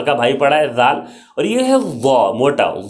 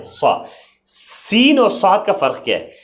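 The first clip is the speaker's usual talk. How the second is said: usual speaking voice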